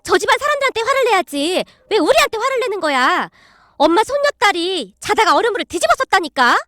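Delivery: a daughter complaining to her mother, voice up high; indignant daughter. Acted. (엄마에게 높은 목소리로 항의하는 딸)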